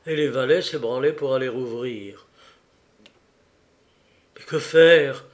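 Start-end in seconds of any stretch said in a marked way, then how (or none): 4.4-5.2 loud, agitated plea; highly agitated. A narrator's reading. Raymond asking the countess for help, loud agitation. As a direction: burst out in helpless agitation. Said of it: "au comble de l'agitation".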